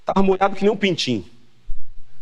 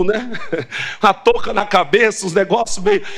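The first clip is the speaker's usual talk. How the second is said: ordinary delivery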